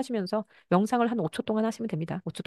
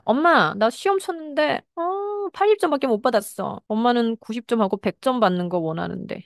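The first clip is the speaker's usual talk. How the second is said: put-on child voice; 5 semitones higher, 3 dB louder than usual. Lecturer voices a child's complaint.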